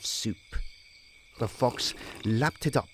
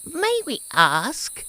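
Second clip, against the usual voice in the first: high, loud, slow prim voice; prim ants; 7 dB louder, slower than usual. Acted. Ants questioning the idle grasshopper, slow high prim tone. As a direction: ask with lofty disapproval.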